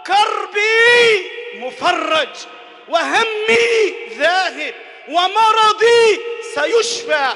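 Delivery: high, loud, slow cry of faith. Pretend. Preacher voicing a believer crying out his faith, loud and slow. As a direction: cry it out slowly to the sky. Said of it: "صرخت بأعلى صوتك وقلت".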